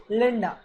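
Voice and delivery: लिंडा with the last a barely said; final a swallowed. Shown as a demonstration. Teacher demos लिंडा for students, final a swallowed. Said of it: "they pronounce it like this".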